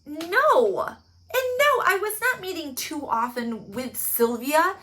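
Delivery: loud, high defensive rant; hammed-up outraged suspect; indignant rant. Brad defending himself to detectives, loud indignant rant.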